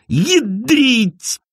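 high pained yelp as the ice hits; character cries out at the falling ice; pained cry. A narrator's reading. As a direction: yelp out in sudden pain. Ice hits his face.

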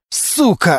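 narrated outburst of rage; angry yell. A narrator's reading. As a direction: curse out in fury, slightly held back. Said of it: "опять заорал Глущенко".

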